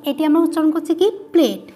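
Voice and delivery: normal talking voice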